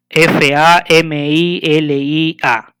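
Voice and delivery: slow letter-by-letter spelling; name each letter slowly and separately; spelled out. Shown as a demonstration. Teacher showing students what makes up a word, spelled out.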